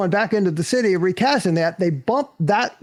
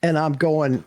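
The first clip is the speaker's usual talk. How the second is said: ordinary talk, nothing marked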